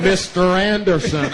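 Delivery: slow, drawn-out agent line; lightly voiced Agent Smith menace; Matrix agent voice. Comic quoting the Matrix agent while security swarms him, slow and menacing. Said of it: "the Matrix movie agents".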